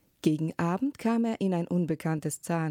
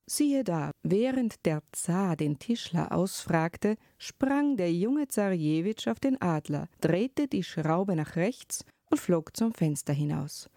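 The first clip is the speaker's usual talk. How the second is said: usual speaking voice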